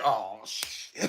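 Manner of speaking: low muttered oh shit; hushed, caught off guard; quiet dismay. Retold or imitated. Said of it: "the dad was in the background like".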